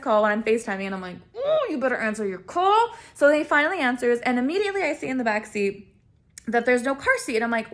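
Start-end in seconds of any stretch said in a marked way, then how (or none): 1.4-2.9 acted sassy warning, pitched up; mock-menacing, higher voice; threatening warning. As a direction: warn him with high-pitched attitude. Acted warning to ignoring boyfriend.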